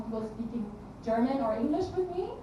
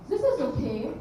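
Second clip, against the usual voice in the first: put-on mildly German-accented praise with a rising end; German accent, approving. Mother to child, approving in a German accent. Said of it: "that was my version of German".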